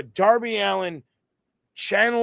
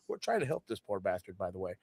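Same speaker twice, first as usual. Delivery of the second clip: muttered mock medic aside, much softer; hushed aside; 10 semitones lower, 12 dB quieter, faster than usual. Medics grumbling about Darby yelling at them, very soft under the breath.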